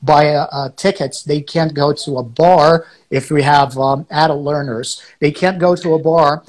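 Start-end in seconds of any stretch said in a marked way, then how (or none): none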